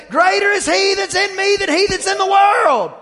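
high, loud preacher shout of scripture; mock uncle shouting faith; shouted declaration. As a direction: fire back at the forecast with faith. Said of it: "And he'd say".

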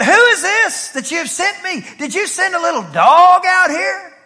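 high, indignant giant's yell; screaming and yelling. Acted. Goliath scorns David walking out to him, high indignant yell. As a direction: yell it high, insulted by the challenger. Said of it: "Goliath begins to scream and yell".

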